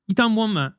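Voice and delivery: ordinary delivery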